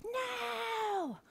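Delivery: put-on high, childlike shout of no; a class of children shouting their answer; kids yelling no. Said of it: "they yelled back".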